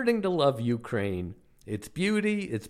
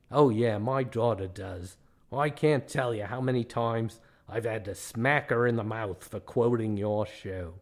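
mean Australian guide; 2 semitones lower than usual. Acted. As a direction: grumble in a broad Australian accent. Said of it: "the tale of the meanest man in Australia".

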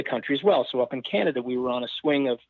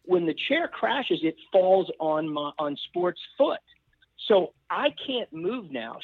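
usual speaking voice

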